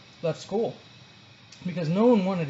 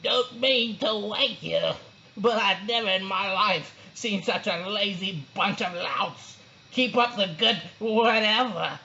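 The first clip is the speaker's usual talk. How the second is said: higher, slow amused principal voice; chortling principal; 3 semitones higher, slower than usual. A narrator's reading. Principal dropping in on sleeping class, chortling. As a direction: chuckle through it like a jolly boss. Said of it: "say with a chortle".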